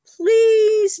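loud, high, drawn-out plea; begging. A narrator's reading. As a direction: beg like eager little cubs. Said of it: "begged Fred, Ted, and Fuzz".

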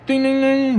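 high-pitched bell dings; imitated ring bell.

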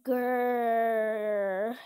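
low playful growl at the game; frustrated growl. Real, not acted. Gamer growling at the screen, frustrated growl. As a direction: growl like an annoyed dog.

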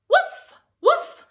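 high, loud little puppy woofs; dog barks. Acted. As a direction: bark it like an excited puppy. Dog barks in picture book.

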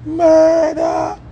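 very high, loud shriek of murder; screaming murder. Retold or imitated. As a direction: imitate the girl bawling out from the back seat. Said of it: "Me hear the girl a bawl out".

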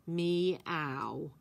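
a lightly voiced, deadpan cat meow; a fake meow, utterly bored; bored human meow. Cat Irwin playing dumb to Herbie, bored deadpan meow. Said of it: "in a bored human voice".

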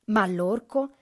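plain reading voice

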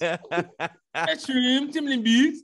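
high, loud Baltimore parody through laughter; mock Baltimore accent. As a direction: pile on exaggerated Baltimore vowels, teasing. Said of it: "Baltimoreans don't sound like that".

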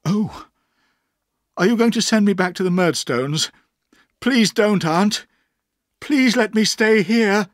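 put-on high, loud, pleading child; frightened boy begging to stay; trembling plea. A narrator's reading. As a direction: beg fearfully, drawing out the last plea.

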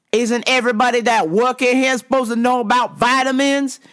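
high, mock indignant demand; aggressive customer. Customer challenging the cashier's knowledge, aggressively. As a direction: imitate an exasperated, pushy man. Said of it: "the attitude is very aggressive".

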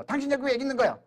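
acted anger. Wife catching her husband elsewhere, acted anger. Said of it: "화를 내야 될 거 아니야".

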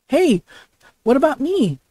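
high, loud objection; high-pitched, put-out cry; indignant protest. Acted. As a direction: pipe up loudly, feeling left out. Left-behind character protests.

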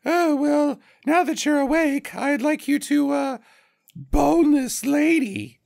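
high put-on lady with borderline British accent; hammed-up fancy lady, drawing out the last words; mock-feminine snooty East Coast voice, very high; Roberta. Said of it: "Roberta sounds just like my lady impression".

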